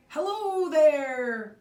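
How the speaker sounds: loud, slow hail from afar; calling out. Acted. A neighbor calls out a greeting from the driveway, loud and drawn out.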